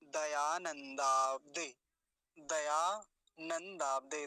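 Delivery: slow, word split into parts; careful drawn-out demonstration; model pronunciation. Shown as a demonstration. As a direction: say it piece by piece. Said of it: "अगला शब्द देखिए".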